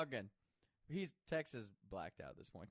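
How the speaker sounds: ordinary delivery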